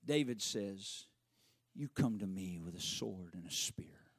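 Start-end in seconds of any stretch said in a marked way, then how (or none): 1.7-4.1 whispered reply. Acted. David answers Goliath.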